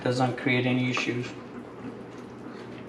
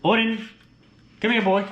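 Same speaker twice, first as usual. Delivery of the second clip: high, louder call across the room; calling the dog; 7 semitones higher, 4 dB louder than usual. Real, not acted. Owner calling his dog Odin to come, raised call.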